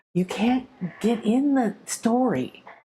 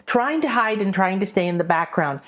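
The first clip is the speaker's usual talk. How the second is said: ordinary talk, nothing marked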